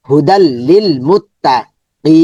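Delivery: normal talking voice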